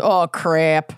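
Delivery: imitating an earnest Minnesota local's "Oh, crap"; earnest Minnesotan. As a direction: quote a northern Minnesota man plainly and sincerely. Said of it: "I heard an absolutely earnest".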